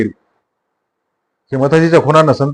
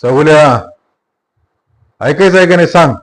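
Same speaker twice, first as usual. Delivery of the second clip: higher, rising demand; raised voice; 3 semitones higher, 3 dB louder than usual. Acted. Sattu demanding Mathaji stop, raised voice. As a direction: push the question at him. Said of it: "तो आवाज चढून म्हणाला".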